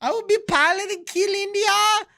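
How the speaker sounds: very high-pitched mock shrieking child; imitated Pakistani kids. Streamer reenacting kids from a crazy video, very high mock yelling. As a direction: squeal it out as an over-the-top kid impression. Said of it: "the kids from Pakistan".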